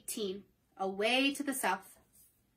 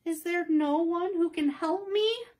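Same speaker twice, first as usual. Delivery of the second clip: higher, slower earnest plea; 6 semitones higher, 4 dB louder, slower than usual. Acted. Dorothy asking the soldier for help, earnest higher plea. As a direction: beg for help sincerely. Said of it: "asked Dorothy earnestly".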